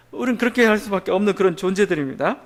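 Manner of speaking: ordinary delivery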